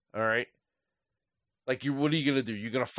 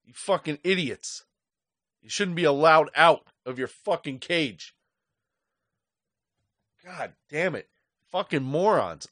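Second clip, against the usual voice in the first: real anger; 3 semitones higher than usual. Real, not acted. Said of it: "that's how angry I am".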